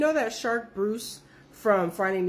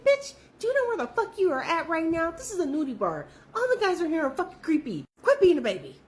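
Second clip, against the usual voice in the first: higher, exasperated mock scolding; sassy scolding; 8 semitones higher than usual. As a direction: tell her off, half joking. Scolding friend in retold quote.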